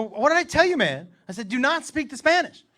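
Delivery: scolding dad. Retold or imitated.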